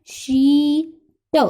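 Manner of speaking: शीट with a long, drawn-out ee, then a separate t; long EE sound. Shown as a demonstration. Teacher sounding out a word for students, शीट with long ee. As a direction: stretch the ee in शीट. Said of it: "इसमें बड़ी ई की sound आएगी".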